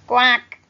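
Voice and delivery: loud sharp duck quack; a short loud quack like a cartoon duck. A narrator's reading.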